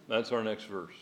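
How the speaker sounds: ordinary talk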